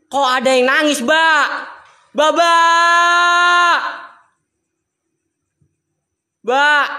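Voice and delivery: loud, high, drawn-out cry; alarmed shout into the distance; frightened calling. Real, not acted. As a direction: shout for help at hearing a ghostly crying. Hears crying, calls out for help.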